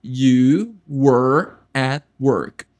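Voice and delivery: You were at work bem lento e claro; devagar. Shown as a demonstration. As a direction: palavra por palavra, com calma. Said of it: "como eu digo, você estava no trabalho". (You were at work, lento, para repetir)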